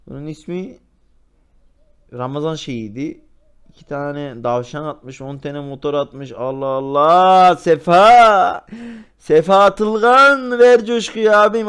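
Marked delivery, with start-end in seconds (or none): 6.3-11.7 loud, slow, hyped shouting; excited shout. Real, not acted. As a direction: cheer the donor on. Shouting at big donation.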